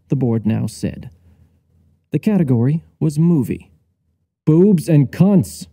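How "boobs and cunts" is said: raised, drawn-out triumphant shout. Acted. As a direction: call it out slowly at the TV. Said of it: "I shouted".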